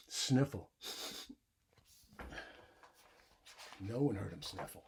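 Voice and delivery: put-on lively read-aloud for young kids; story reading. A narrator's reading. Teacher reading a bedtime story to the class, animated. Reads picture book aloud.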